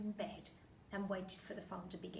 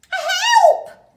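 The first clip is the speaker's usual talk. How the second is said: very high, loud scream for help; 28 dB louder than usual. Acted. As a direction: shriek for help in panic. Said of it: "cried, screamed Missus Twit".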